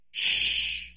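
forceful unvoiced shushing; loud shush. Crowd shushing the beggar, loud shush. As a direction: silence him with a hiss.

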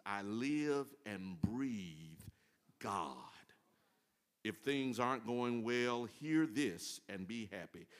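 slow, measured reading of a psalm; scripture reading. Acted. Preacher reading Psalm 34 slowly to the church. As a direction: read each line slowly and reverently.

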